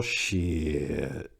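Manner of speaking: ordinary delivery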